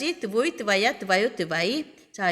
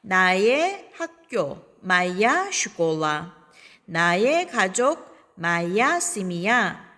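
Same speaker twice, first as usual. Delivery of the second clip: slow, clear teacher drill; slow model reading; 2 semitones lower, slower than usual. Shown as a demonstration. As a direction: say each pair slowly for students. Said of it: "바로 넣어서 말하겠습니다". (천천히 또박또박 시범)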